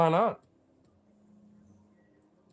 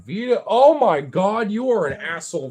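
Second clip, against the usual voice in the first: exasperated, louder, higher oh-my-god; exasperated outburst; 5 semitones higher, 8 dB louder than usual. Real, not acted. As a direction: scold the pet loudly in disbelief. Exclaiming at misbehaving pet.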